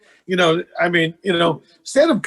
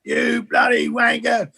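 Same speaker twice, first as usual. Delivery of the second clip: shouted in a put-on British accent, higher; mock British heckle; 5 semitones higher than usual. British heckler shouting down an American comic in mock British accent. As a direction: bark it like a drunk British heckler. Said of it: "And I said".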